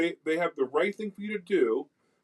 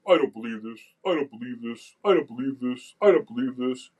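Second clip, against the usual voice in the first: imitated skeptics; 3 semitones lower than usual. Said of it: "These skeptical people".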